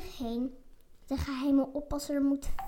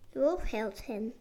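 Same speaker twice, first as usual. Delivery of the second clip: ordinary delivery, nothing marked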